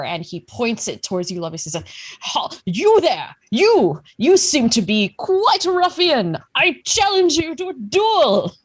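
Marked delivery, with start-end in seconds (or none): 2.2-8.5 high, loud military challenge; blustering old gentleman. Acted. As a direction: bark it like a pompous old officer. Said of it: "like a rather older gentleman".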